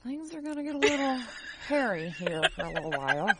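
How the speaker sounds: talking through a chuckle; amused, giggly delivery; laughing. Real, not acted. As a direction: let a laugh break into the words. Laughing while warning of weirdness.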